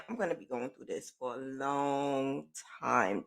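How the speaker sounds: hushed, trailing worried thought; quiet inner thought. Retold or imitated. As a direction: say it under your breath, fearing. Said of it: "now I'm thinking".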